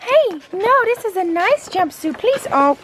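very high, cooing dog voice. Real, not acted. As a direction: gush in a squeaky pet-owner coo. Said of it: "baby talk your dogs".

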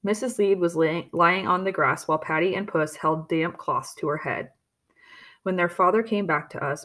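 usual speaking voice

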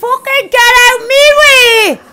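loud slow angry shout; frantic yell. Real, not acted. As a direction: yell them out of the way.

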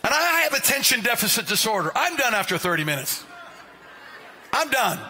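ordinary delivery

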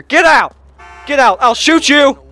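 yelling at the driver to get out; shouting. Real, not acted. Carjacking a driver, yelling him out of the car.

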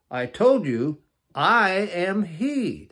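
loud, rising to a high, stretched-out "I am he"; insist, then proclaim it very slowly; emphatic declaration. Acted.

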